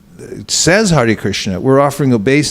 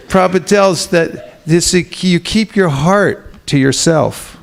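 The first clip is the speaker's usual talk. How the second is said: ordinary talk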